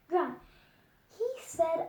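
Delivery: ordinary delivery, nothing marked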